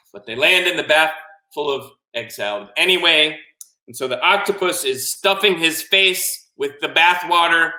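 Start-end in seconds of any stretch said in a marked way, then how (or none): none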